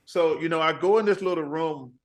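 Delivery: normal talking voice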